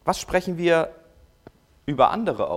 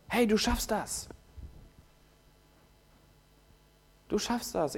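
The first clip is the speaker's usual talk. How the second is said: encouraging; 5 semitones higher, 8 dB quieter than usual. Retold or imitated. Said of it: "positive Saat".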